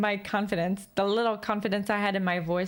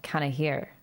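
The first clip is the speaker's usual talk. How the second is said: lower everyday voice; 7 semitones lower than usual. Shown as a demonstration. Showing viewers how they talk to Alex, lower everyday voice. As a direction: drop to a low, relaxed register. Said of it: "I'm talking to you very lively".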